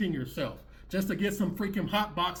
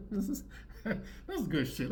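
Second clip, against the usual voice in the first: laughing; 8 dB quieter than usual. Real, not acted.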